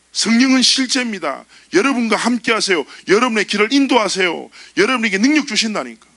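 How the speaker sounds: high, loud insistent preaching; full-force preaching. Acted. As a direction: drive it home to the congregation. Preaching climax.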